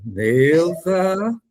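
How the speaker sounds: "Neuza" drawn out, rising at the end; questioning call. Pretend. Acting out asking who answers.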